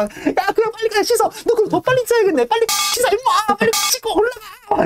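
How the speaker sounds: very high, laughing taunt; mocking tease. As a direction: jeer at him to hurry and wash. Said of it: "다들 막 놀려대고".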